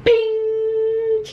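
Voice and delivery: high ringing bing; bing sound effect. Acted. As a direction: ring it out high.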